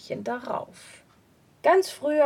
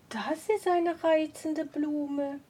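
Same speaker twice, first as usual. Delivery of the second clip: delighted woman; 3 semitones higher, slower than usual. Acted. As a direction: marvel gently at the flower. Woman admires the flower.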